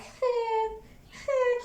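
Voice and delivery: high, forced hey, hey; mock forced greeting with a fake smile; fake cheerful greeting. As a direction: sell a smile you don't feel. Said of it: "it will look like a prosthetic smile".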